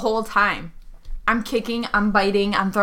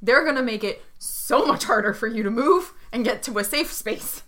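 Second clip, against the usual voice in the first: normal talking voice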